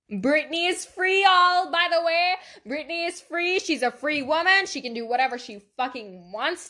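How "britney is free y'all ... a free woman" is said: excited cheer. Real, not acted. Excited repetition.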